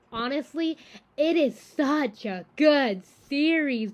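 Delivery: real excited insistence, building in volume; emphatic gushing. Real, not acted. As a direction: punch each word slowly and loudly. Gushing recommendation of the show.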